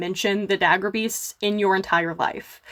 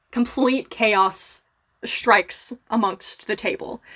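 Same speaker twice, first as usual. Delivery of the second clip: normal talking voice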